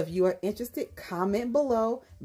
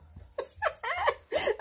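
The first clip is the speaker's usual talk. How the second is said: high laugh. Real, not acted. Telling viewers the price, a high laugh.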